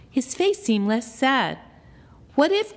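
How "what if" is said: high, louder what if; light up with an idea; sudden idea. A narrator's reading. Grace struck by a sudden thought. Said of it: "Grace thought".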